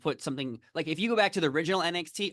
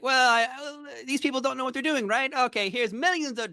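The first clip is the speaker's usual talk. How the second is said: mock executive voice; 10 semitones higher than usual. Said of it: "to be like".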